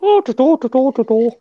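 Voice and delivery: high-pitched mock-Irish gibberish; unintelligible Irish imitation. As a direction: babble nonsense syllables like an Irishman. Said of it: "you can't understand what they're fucking saying".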